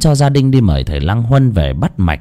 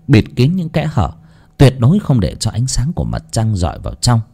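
ordinary delivery, nothing marked

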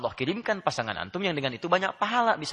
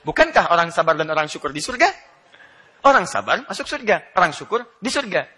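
emphatic punchline; 4 semitones higher, 9 dB louder than usual. Retold or imitated. Wife's witty punchline, emphatic.

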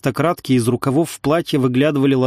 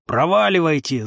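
higher-pitched, restrained narrator's yell; mock-yell it, pitch raised; angry yell; 4 semitones higher than usual. A narrator's reading. Villager driving riders away from the gate, mild yell. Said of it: "заорал он".

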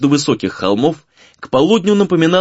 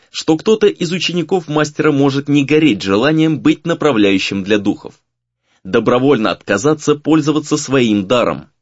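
plain reading voice

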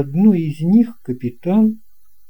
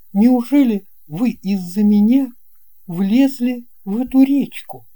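put-on much higher, louder young boy; an amazed little boy asking the captain; boy's voice; 10 semitones higher, 5 dB louder than usual. (высокий удивлённый мальчишеский голос)

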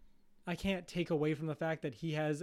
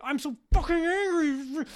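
much higher, much louder, mock screaming rage; hammed-up furious shout; 11 semitones higher, 9 dB louder than usual. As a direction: explode in a cartoonish scream. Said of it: "And you're like".

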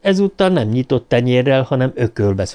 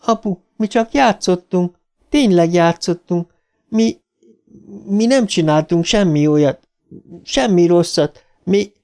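put-on higher-pitched voice of a frightened girl protesting; pleading daughter; 8 semitones higher than usual. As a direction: plead innocence to your father in a high voice. (magas hangon mentegetőző lány)

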